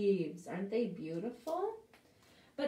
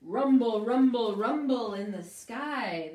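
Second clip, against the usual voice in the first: loud booming rumble; thunder voice; 8 dB louder than usual. Acted. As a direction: boom it out like thunder. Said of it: "the thunder says".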